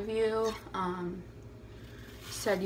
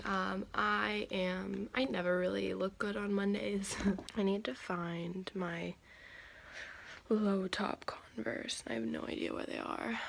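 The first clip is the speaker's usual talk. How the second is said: soft morning whisper; barely voiced, hushed; 10 dB quieter than usual. Real, not acted.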